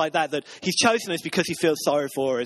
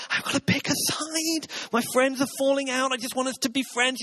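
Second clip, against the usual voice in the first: higher, pleading younger self; pleading child; 7 semitones higher than usual. Retold or imitated. Preacher voicing his younger self, pleading for peace. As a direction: beg your friends to make up. Retelling own childhood plea.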